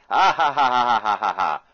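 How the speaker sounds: booming vampire count cackle, high and loud; loud theatrical count laugh; Count's laugh. Retold or imitated. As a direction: throw back your head and laugh like the Count. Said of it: "What is he, the Count?".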